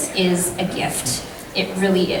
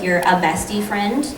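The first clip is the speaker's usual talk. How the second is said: normal talking voice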